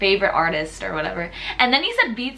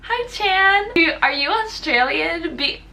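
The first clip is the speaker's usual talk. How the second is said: flirty pickup line; 9 semitones higher than usual. Retold or imitated. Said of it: "use the freaking pickup line on him".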